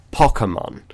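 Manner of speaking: Pokemon with the short O of "pocket"; pocket-style pronunciation. Shown as a demonstration. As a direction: say Pokemon as POCK-uh-mon. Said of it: "'cause it's pocket monsters".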